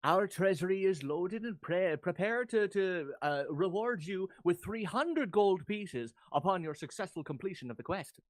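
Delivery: put-on stately Irish-accented town official. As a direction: make a formal offer in an Irish lilt. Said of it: "He'd be like".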